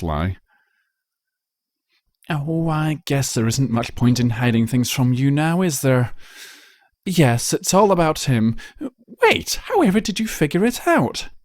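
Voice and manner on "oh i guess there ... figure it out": lightly raised, sighing confession turning curious; refined unicorn owning up; resigned Rarity. Acted. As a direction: give in, then wonder. Rarity admits the truth.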